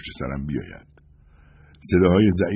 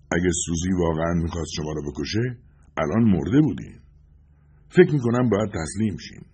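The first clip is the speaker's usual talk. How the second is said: usual speaking voice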